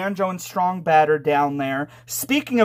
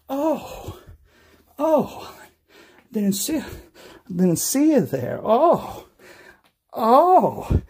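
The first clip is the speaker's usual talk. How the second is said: mock startle; 6 semitones higher, slower than usual. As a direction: act startled like caught off guard. Fake surprise seeing someone.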